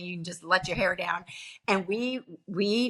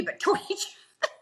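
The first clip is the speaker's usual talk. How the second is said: laughing; 10 semitones higher, much faster than usual. Real, not acted. Amused retelling of viral comments.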